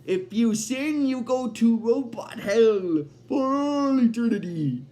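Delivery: slow, drawn-out villain pronouncement; Robot Devil. Retold or imitated. Devil explaining the contract to Bender, slow theatrical voice. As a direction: stretch each phrase like a grand sentence of doom. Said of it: "the robot devil explains to him that".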